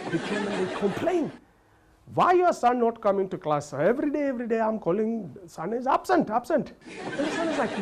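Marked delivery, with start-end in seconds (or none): 2.1-6.7 put-on high, lilting Indian-accented complaint; mock Indian teacher. Teacher complaining to the father, mock Indian accent.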